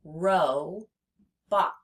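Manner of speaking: "Ro-bot" split, long O then short O; slow contrast of the vowels in "Ro-bot"; vowel contrast demo. Shown as a demonstration. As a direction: stretch Ro, pause, then say bot. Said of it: "difference between those two O's".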